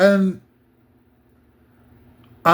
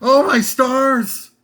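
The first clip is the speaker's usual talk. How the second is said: mock Ali; 5 semitones higher, 3 dB louder, much slower than usual. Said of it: "and Ali's like".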